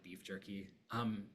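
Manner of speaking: usual speaking voice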